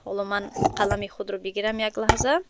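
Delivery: usual speaking voice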